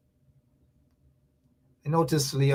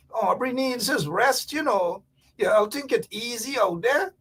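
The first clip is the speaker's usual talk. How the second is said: a hint of a kindly older caller, higher; nicely voiced caller; 7 semitones higher than usual. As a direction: pass on the advice in a light put-on caller voice. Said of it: "in a nice way".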